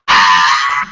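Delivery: loud excited ah; loud burst of joy; joyful shout. Retold or imitated. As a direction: yell with delight at the money. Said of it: "I'm like".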